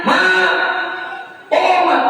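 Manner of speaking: put-on high little baby voice; preacher voices baby calling mother, high; baby speaking. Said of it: "Apa bilang anaknya?".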